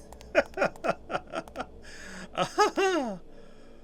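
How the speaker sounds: high, wheezy real giggle; long high laugh after a scare; nervous laugh. Real, not acted. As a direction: giggle off the fright in high bursts.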